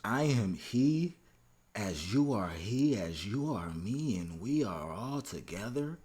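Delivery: slow, deliberate poetry reading; dramatic lyric recitation. Acted. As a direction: recite each phrase with weighty pauses. Reads lyrics out for analysis.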